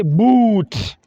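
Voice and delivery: boot with the oo stretched out; boot, long and slow on the oo; long vowel in boot. Shown as a demonstration. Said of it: "testing the long and the short".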